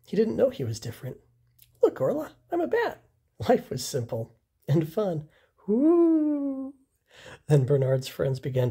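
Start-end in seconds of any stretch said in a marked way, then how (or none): 1.8-3.0 put-on much higher, childlike bird voice; a small chick showing off his game; little Bernard. Young Bernard playing proudly. 5.7-6.7 high, long joyful woo; a chick whooping with delight; gleeful whoop. Acted. Bernard playing, having fun.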